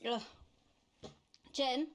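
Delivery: irritated Australian newsreader off duty; Australian accent. Acted. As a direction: put on a brisk Australian accent. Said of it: "Why is she Australian?".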